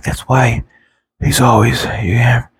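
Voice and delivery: breathy whisper imitating the president. Vlogger imitating the president's whisper, hushed whisper. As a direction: drop to a thin whisper to show how he talks. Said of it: "you hear him whispering like that".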